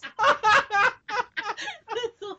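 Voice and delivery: high-pitched cackling laugh; burst of laughter. Real, not acted. Hosts cracking up at the show's opening, laughing. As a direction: crack up, then trail into giggles.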